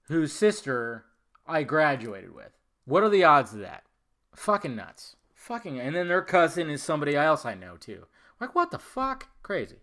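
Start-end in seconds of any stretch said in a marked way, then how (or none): none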